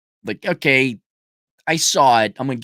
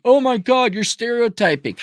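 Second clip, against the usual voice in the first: mock offended critic; 8 semitones higher than usual.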